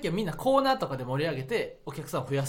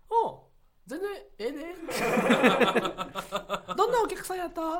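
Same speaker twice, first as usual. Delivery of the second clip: high-pitched impression of a senior comedian; 10 semitones higher than usual. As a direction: imitate him in a much higher voice. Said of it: "森山さんが". (先輩芸人の高い声真似)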